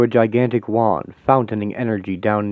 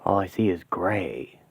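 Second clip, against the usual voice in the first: ordinary talk, nothing marked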